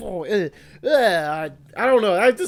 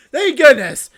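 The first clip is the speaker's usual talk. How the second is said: relieved exclamation; 4 semitones higher, 9 dB louder, slower than usual. Real, not acted. Relief after not failing.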